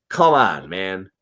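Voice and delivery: usual speaking voice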